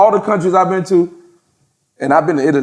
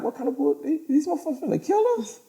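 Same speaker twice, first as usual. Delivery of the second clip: higher, softer mock panic; whisper-panic like they're about to get killed; mock scared; 11 semitones higher, 11 dB quieter, faster than usual. Teller at a dark dock fearing the boat, mock scared and high. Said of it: "I'm like".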